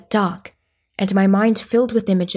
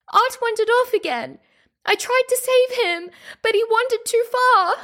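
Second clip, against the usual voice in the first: put-on very high, distressed child's voice; whimpering plea. A narrator's reading. Child explains to her angry mother, high whimpering plea. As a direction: plead tearfully, pitch way up.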